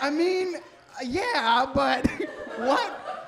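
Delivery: high, loud, bewildered; confused counselor facing the patient, baffled. Retold or imitated. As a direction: stammer in disbelief. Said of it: "I was like".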